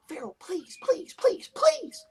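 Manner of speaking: imitating a pleading woman, pitch climbing; imitating pleading girlfriend. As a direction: beg like she's hiding scared. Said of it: "it sounded like she was hiding in the closet".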